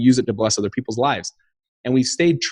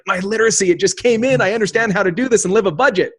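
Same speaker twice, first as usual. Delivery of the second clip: high, mock-smug imitation of someone suddenly good with money; mocking a suddenly literate spender; 8 semitones higher than usual. Mocking people who think money skills appear overnight, high and smug. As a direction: send up the overnight expert, up high. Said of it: "It's like".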